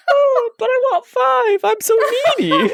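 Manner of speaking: hammed-up neediness; mock needy plea. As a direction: beg playfully in a high voice. Joking plea for five stars.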